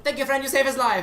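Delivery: high, loud imitation of a thick foreign accent; broken English accent. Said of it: "he says the exact same thing".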